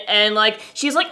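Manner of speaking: normal talking voice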